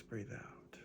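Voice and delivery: breathed out in a whisper. Shown as a demonstration. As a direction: let the cue fade into a whisper. Breathing cue in meditation.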